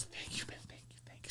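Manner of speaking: breathless whispered thank-you; mock heartfelt whisper of thanks. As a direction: whisper gratitude as if deeply moved. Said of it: "He goes".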